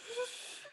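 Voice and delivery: shaky little gasp, imitating a child; imitated tearful breath. Crying little girl told by her mother to breathe, gasping. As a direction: mimic a toddler trying to breathe. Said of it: "her mother is telling her to breathe".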